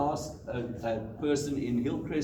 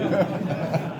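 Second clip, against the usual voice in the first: a loud, high laugh that breaks out; burst of laughter; 5 semitones higher, 6 dB louder than usual. Real, not acted. Runner laughs at his pretend call to his wife, bursting out. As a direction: laugh out loud at your own joke.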